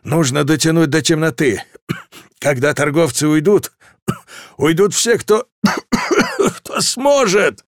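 straining voice between coughs; coughing and struggling to speak; coughing through words. Acted. Dying captain coughing through orders.

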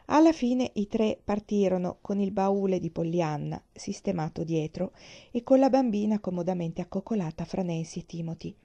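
ordinary delivery, nothing marked

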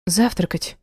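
put-on lightly blurted girl's reply; quick little blurt. A narrator's reading. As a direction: answer at once, a touch eagerly. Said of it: "выпалила девушка".

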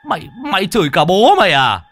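high, loud outraged shriek. Acted. Startled exorcist shrieks at the ghost that cursed him. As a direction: shriek it in disbelief. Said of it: "mà rít lên".

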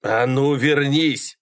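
drawn-out exasperated yell; yelled order. A narrator's reading. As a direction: stretch out the shouted command. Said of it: "снова заорал Малькольм".

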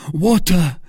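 high, loud call; urgent cry. A narrator's reading. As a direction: shout for water in alarm. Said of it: "cried D'Artagnan".

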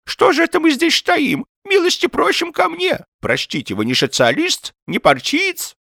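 high, agitated host; flustered old man. A narrator's reading. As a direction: fuss over the guest in a high old voice. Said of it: "заволновался старик".

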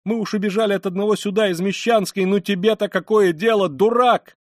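high, loud indignant tirade; angry shouting. A narrator's reading. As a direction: lash out at the policeman. Woman rails at the policeman. (громко, гневно, на высоких нотах)

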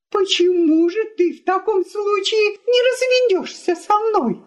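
very high, outraged challenge; indignant exclamation. A narrator's reading. Wife dares husband to divorce, indignant. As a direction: cry it out in tearful outrage. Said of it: "дрожа от возмущения, воскликнула жена".

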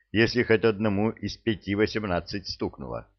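ordinary delivery, nothing marked

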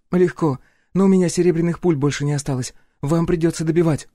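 cool sniper on the radio; female sniper voice. A narrator's reading. Polina answers by radio. (голос Полины по рации)

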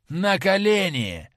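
high, loud and slow bark of an order; shouted command. A narrator's reading. Attacker to Dorian at gunpoint, a loud, high, slow shout. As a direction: drag each word out as a furious shout. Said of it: "закричал человек".

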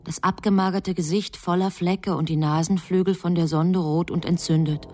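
ordinary talk, nothing marked

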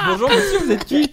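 high, laughing interviewer voice; mock interviewer. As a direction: greet the odd guest like a reporter. Mock interview with film fan.

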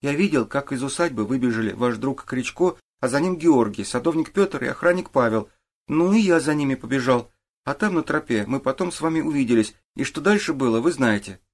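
lightly voiced boy giving his account; boy witness. A narrator's reading. Boy tells the detective what he did, earnest.

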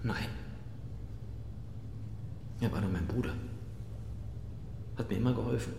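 barely able to say it; hollow, broken admission; quiet grief. Acted. Murderer admits killing his brother.

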